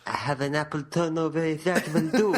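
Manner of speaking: high-pitched mock French waiter. Waiter offering an apple turnover, high mock French accent. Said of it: "where the waiter comes out and goes".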